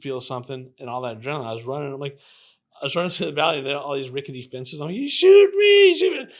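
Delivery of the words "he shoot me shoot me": very high, loud panicked scream. Retold or imitated. Shot man fleeing over fences, screaming for attention. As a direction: scream it out for the whole street to hear. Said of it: "I'm screaming".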